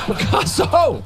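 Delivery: Picasso! shouted high with a put-on Italian flourish, falling at the end; mock Italian shout. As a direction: belt out Picasso! like an Italian fan. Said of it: "I hear O, I think Italian".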